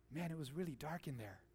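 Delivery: low, hushed mock remark; imagined revived man. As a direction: play the dazed man, softly and low. Imagines risen dead man's first words.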